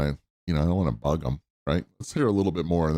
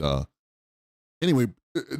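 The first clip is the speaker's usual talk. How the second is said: usual speaking voice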